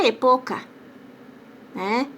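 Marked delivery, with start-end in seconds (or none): 0.1-0.6 "epoca" com a força na sílaba PO; sem acento. Shown as a demonstration. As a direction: diga "epoca" com E fechado e PO tônico. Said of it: "Se eu tirar o acento". ("epoca" paroxítona, tônica no meio)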